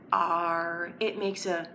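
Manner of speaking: ordinary talk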